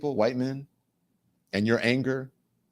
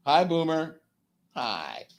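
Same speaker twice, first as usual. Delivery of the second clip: talking to a dog; 8 semitones higher, slower than usual. Real, not acted. Greeting his dog.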